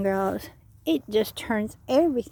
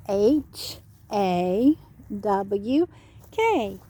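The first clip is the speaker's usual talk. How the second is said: spelling out; 2 semitones lower, much slower than usual. Shown as a demonstration. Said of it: "learn how to spell hawk".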